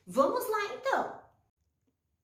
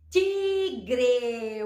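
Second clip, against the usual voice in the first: tigre drawn out as two long syllables; 4 semitones lower, 4 dB louder than usual. Shown as a demonstration. Teacher introducing a word with ti, syllable by syllable. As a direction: stretch tigre into ti and gre. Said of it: "com ti a gente pode escrever".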